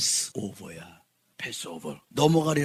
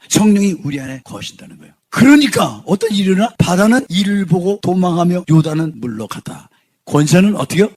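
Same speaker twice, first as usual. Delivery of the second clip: loud, full-force preaching; booming sermon delivery; forceful preaching; 2 semitones higher, 10 dB louder than usual. Real, not acted. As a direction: proclaim it loudly to the congregation.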